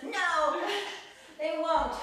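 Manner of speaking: stubborn stomach. Retold or imitated. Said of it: "They're, they're just".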